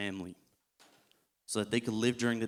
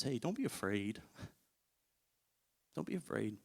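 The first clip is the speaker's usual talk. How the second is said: reassuring; 8 dB quieter than usual. Retold or imitated. Jesus comforting frightened Peter.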